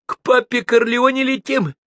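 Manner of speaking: high, loud, manic announcement; excited yell. A narrator's reading. Madman announcing a flight to Corleone, excited yell. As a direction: cry it out triumphantly at high pitch.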